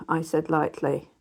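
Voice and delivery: plain reading voice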